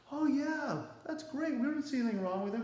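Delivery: mock Pharisees. Teacher voicing Pharisees siding with Paul, high gushing approval. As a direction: chirp it out like eager agreeing Pharisees. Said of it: "They're like".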